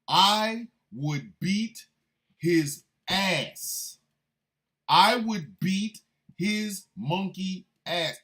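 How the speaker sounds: I would beat drawn out slowly and loud; slow emphatic threat. Real, not acted. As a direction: lean hard on every word of I would beat. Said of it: "I know this about myself".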